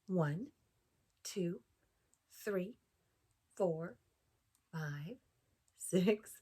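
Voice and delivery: put-on slow counting aloud for kids; teaching a child to count. Shown as a demonstration. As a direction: count each marshmallow slowly.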